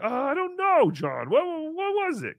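high, feigned ignorance; mock playing dumb. Host imagining humouring John's trivia, high and mock innocent. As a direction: pretend not to know to please him. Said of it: "I would've played it the same way".

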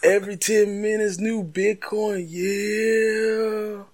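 high, slow drawled rapper voice. Acted. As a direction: stretch every word out slow and high.

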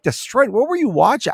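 high-pitched disbelieving retort; incredulous retort. Retold or imitated. Fan answering McGregor's haters, incredulous.

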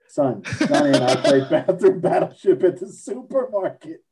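parent answering through laughter; laughing parent voice. Pretend. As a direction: laugh through the mother's reply.

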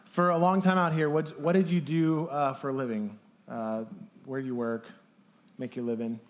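ordinary delivery

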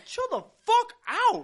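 very high, drawn-out mock protest; exasperated protest. Drinker begging heckling friends off, high exasperated cry. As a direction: squeal it slowly at the hecklers.

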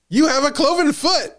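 high, flat-out accusing; mock Anne blurting the accusation; blunt accusation. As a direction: blurt it out with no chill. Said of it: "she says".

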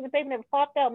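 ordinary delivery

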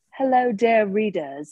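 attempted British accent for a period-drama narrator; Julie Andrews. Guest attempting a Lady Whistledown introduction, British accent. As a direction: greet the readers in a put-on English accent. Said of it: "can I do Julie Andrews?".

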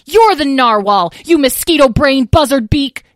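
high, loud insult yelling; young dragon yelling insults mid-fight; yelled insults. Acted. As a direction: fling insults at full volume. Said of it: "yelled Lyle".

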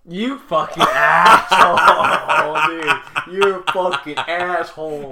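laughing through the insult, drawn out; laughing loud and slow at a friend's story. Real, not acted. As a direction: cackle it out at your buddy.

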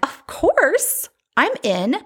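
high, excited yes, jumping at it; thrilled high-pitched agreement; eager acceptance. Retold or imitated. Acts out her eager acceptance.

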